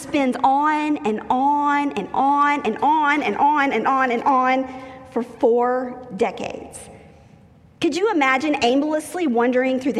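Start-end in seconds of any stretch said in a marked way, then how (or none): 0.0-6.8 slow, drawn-out on and on; weary, slow listing of the years; dragged out repetition. Acted. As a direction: stretch every on to feel the endless years. Endless cycle drawn out slowly.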